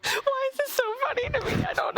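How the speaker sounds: high giggling question; laughing. Real, not acted. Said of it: "I just can't stop laughing".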